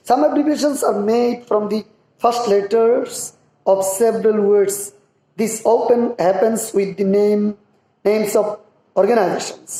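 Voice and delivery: slow, careful English read; measured classroom reading; slow reading aloud. Shown as a demonstration. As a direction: spell out the textbook sentence slowly. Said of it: "reads English passage aloud".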